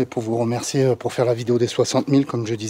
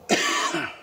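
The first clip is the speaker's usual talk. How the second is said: cough; 4 semitones higher than usual. Real, not acted. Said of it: "vous pouvez m'entendre tousser".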